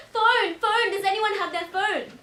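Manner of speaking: high, loud, forceful call; urgent shouting. Acted. Witness reliving the call for a phone to prove the story, shouting. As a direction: shout it out as proof.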